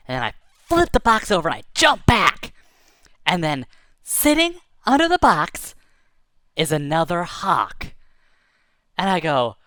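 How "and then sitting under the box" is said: ordinary delivery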